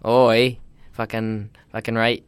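hammed-up Canadian accent; mock Canadian guy talk. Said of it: "very Canadian, like".

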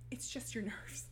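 whispered, soft comfort; hushed reassurance. Retold or imitated. Mother tucking daughter into bed, whispering reassurance. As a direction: whisper it to calm her.

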